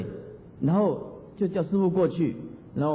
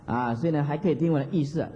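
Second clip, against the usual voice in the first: normal talking voice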